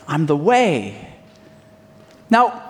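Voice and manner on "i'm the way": hushed solemn claim. Acted. Repeating Jesus' claim, hushed.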